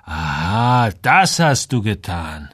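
slow, drawn-out acknowledgement; pensive realisation. Acted. Samuel takes in what Joel has done, slowly pensive. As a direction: stretch the words as it dawns on you.

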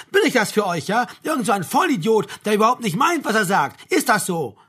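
loud, high, outraged yelling; enraged officer in full rant; bellowing. A narrator's reading. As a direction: hurl it as a furious accusation. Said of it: "brüllte der Major".

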